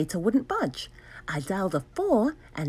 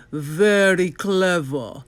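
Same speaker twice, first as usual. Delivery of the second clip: slow, louder sneer; 5 dB louder, much slower than usual. Acted. Doctor Brock sneers at the hero, slow sneer. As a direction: drag it out with contempt. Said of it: "said with a sneer".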